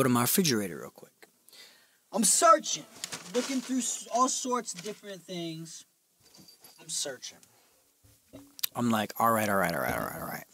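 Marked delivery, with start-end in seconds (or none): 2.1-7.4 high, soft, trailing sing-out; absorbed searching. Retold or imitated. Man rummaging through his refrigerator, high hushed searching. 9.2-10.4 low, soft, repeated mumble; muttered scheming. Retold or imitated. Man hatching an idea alone, low muttering.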